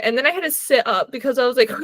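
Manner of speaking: usual speaking voice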